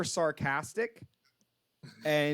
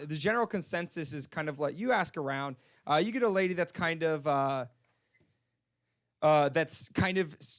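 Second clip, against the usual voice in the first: ordinary delivery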